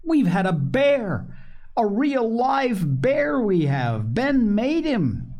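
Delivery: put-on high, drawn-out childish announcement; slow, thrilled small-child voice; excited little child. A narrator's reading. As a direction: tell mother the big news, pitch way up. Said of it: "cried Phronsie, jumping out of Polly's arms".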